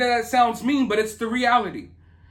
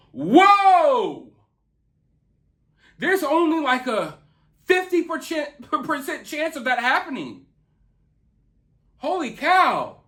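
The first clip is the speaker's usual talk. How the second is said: hammed-up shock, higher and slow; mock amazement; 5 semitones higher, slower than usual. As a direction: fake wonder at nothing.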